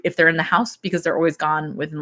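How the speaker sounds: ordinary talk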